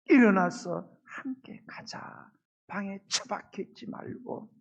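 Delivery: slow whisper, tender and intimate; whispered call. Acted. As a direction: breathe the invitation like a secret. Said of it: "주님이 말씀하시는 거예요".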